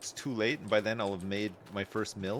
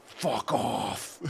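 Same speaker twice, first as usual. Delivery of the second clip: angry; 5 dB louder than usual. Real, not acted. Angry at birds after bird voice.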